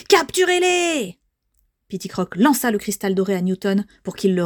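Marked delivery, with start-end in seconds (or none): 0.1-1.2 high, drawn-out villain's order; shouted command. Acted. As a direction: cry out the order to attack, stretched. Villain Shadow orders an attack. (ordre crié, aigu et traînant)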